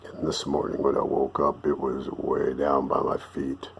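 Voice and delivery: very low, soft bass rumble. Shown as a demonstration. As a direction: drop the voice into the basement. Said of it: "my voice goes way down in the basement".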